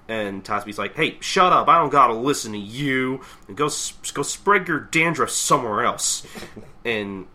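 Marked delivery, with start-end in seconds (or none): 0.9-6.3 loud mock-annoyed brush-off; raised voice, defiant; angry retort. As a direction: tell the chief off. Acted quarrel with fire chief.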